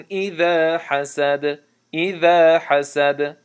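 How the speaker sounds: slow melodic tajweed chant, drawn out; Quran recitation. Shown as a demonstration. Teacher recites al-Falaq to students in slow tajweed chant. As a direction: recite each verse slowly, measured and melodic.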